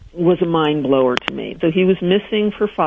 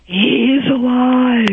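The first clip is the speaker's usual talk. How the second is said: slow, smoky, higher psychic pronouncement; raspy psychic voice; 7 semitones higher, 3 dB louder, much slower than usual. Retold or imitated. Imitating the psychic to the radio hosts, slow and smoky. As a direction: breathe it out like a chain-smoking psychic. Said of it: "From your phony smoke".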